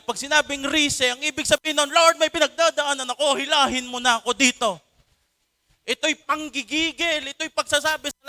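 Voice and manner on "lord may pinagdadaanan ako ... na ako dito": higher, louder desperate prayer; a cry to be dragged out of trouble; urgent plea. Shown as a demonstration. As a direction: beg to be pulled out now. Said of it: "Pag sinabing rusa".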